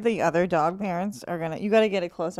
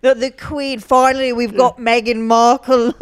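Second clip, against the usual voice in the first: loud, higher British cheer; British accent; 4 semitones higher, 11 dB louder than usual. Acted. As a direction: jubilant Londoner. British voice bit continues.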